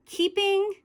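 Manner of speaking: high, loud burst of delight; excited squeal. Real, not acted. Excited squeal while sorting glosses, high and loud. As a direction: squeal it out, thrilled with the pick.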